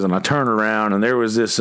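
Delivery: ordinary talk, nothing marked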